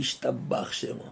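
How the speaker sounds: whispered through a laugh; amused whispered aside. Real, not acted. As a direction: chuckle it under your breath. Repeats delighted catchphrase.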